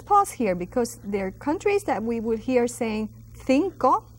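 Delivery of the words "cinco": "cinco" with a TH for the first C; TH-sound pronunciation. Shown as a demonstration. Teacher showing students how Spain says it, "cinco" lisped to TH. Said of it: "they would say cinco with a T H sound".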